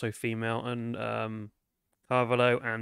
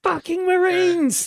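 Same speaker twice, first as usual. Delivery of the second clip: much higher, drawn-out exasperated yell; angry shout; 12 dB louder, slower than usual. Acted. Dancer cursing Marines who refuse a tip, angry shout. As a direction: yell it out in outrage.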